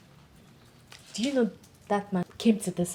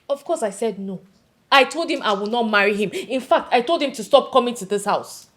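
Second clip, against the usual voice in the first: loud, indignant retelling of a refusal; worked up; 8 dB louder than usual. Acted. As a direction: get more heated with every sentence. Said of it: "Okay, calm down".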